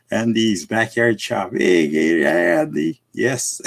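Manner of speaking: ordinary talk, nothing marked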